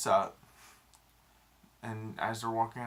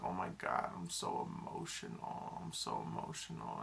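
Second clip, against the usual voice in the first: breathy whispered self-pity, much lower and softer; mock teary whisper; 12 semitones lower, 7 dB quieter than usual. Retelling her movie reaction, mock teary whisper. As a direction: whisper it like you're welling up at the screen. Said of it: "I'm like".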